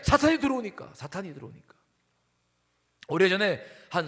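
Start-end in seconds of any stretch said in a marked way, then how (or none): 0.0-0.9 high, loud preacher's warning; emphatic warning. Real, not acted. Preacher telling congregation why faith must stand, emphatic warning. Emphatic answer, then quieter repeat. 0.9-1.7 soft, subdued echo of a warning; hushed repeat. Real, not acted. Preacher repeating the reason to congregation, quietly subdued.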